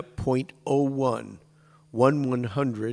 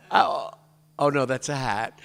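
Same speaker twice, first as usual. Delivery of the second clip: ordinary talk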